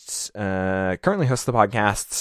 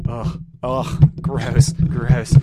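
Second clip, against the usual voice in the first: disgusted groan, louder; grossed out ugh, pushed louder; annoyed ugh; 5 semitones higher, 7 dB louder, much slower than usual. Real, not acted. Disgusted by the screeching noise.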